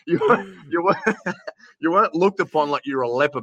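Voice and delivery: high-pitched, laughing through the words; real laugh breaking up the restart. Real, not acted. Guest joking to host about pre-covid colds, laughing.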